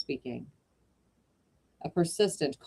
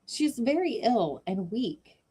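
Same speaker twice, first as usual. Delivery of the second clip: put-on higher, slow young woman's voice; Sue pleading; 7 semitones higher, slower than usual.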